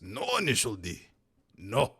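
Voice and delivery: lightly voiced raspy old Japanese man; a hint of a raspy old Japanese man. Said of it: "raspy old Japanese man voice".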